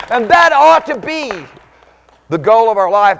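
high, loud, shouting sermon climax; full-force preaching. Acted. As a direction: preach it out at the top of your voice.

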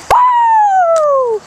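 high, loud cheering whoop; excited whoop. Real, not acted. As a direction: cheer the dog on loudly. Cheering dog over jump.

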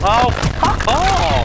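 slow, high drawn-out rage; raging gamer. Real, not acted. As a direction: stretch out an angry fuck off. Killed again, yells.

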